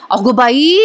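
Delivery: ordinary delivery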